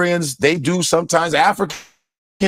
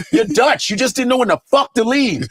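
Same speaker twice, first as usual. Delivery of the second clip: normal talking voice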